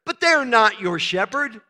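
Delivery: ordinary delivery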